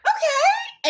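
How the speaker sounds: surprised okay. Retold or imitated. Reacting to articles, retold.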